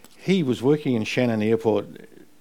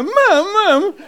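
mock protest; 9 dB louder than usual. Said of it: "And we all go".

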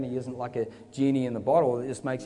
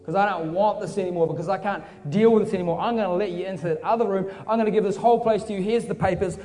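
raised, building, hurried offer; urgent surrender; 3 semitones higher, 3 dB louder than usual. Acted. Man signs his whole house over to Jesus, urgent. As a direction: pour it all out in an eager rush.

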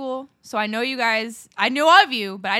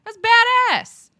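emphatic praise; 10 semitones higher than usual. Real, not acted. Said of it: "You're, like, spinning guns".

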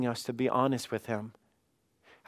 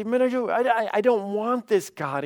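high-pitched, stammered plea; acted upset, voice pitched up; frustrated protest; 7 semitones higher than usual. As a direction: protest to God, acting exasperated. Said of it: "you're just so frustrated with something".

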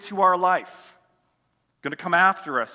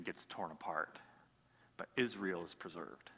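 softer, lower story voice; hushed storytelling; 8 semitones lower, 9 dB quieter than usual. A narrator's reading. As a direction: draw them in quietly. Quiet retelling of Daniel story.

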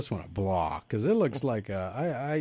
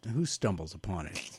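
normal talking voice

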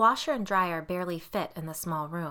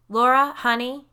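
loud, higher call to another room; raised calling voice, rising at the end; yelling; 7 semitones higher, 11 dB louder than usual. A narrator's reading. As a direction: holler for a partner. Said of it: "he yelled".

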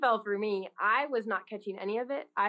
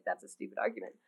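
much lower, quieter dad voice; dismissive dad; 12 semitones lower, 7 dB quieter than usual. Retold or imitated. Father dismissing his teen daughter's worry, low and dry. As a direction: brush it off like an unimpressed father. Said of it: "And my dad's like".

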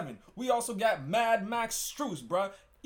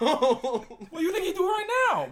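laughing; 10 semitones higher, 5 dB louder than usual. Real, not acted. Laughing tease.

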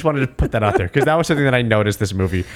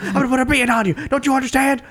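much higher mock tough-guy threat; Clubber Lang impression; 10 semitones higher, much faster than usual. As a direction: ham up a boxer's taunt, pitched way up.

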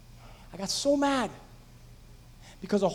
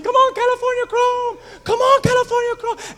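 much higher, louder desperate cheer; screaming at the TV; 6 dB louder than usual. Retold or imitated. Fan at home screaming at the TV for his horse, frantic loud cheering. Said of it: "And I'm screaming".

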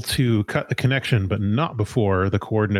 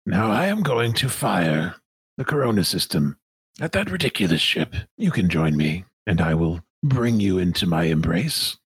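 Sun Minister De Winton; 2 semitones lower than usual. Acted. Villain gives an ultimatum.

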